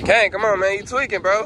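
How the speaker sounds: high, loud call across the yard; loud high shout for the dog. Real, not acted. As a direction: call your dog from far away. Calling the dog across the field.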